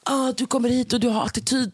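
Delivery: high-pitched mocking imitation; imitated scolding. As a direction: mimic a bouncer telling someone off.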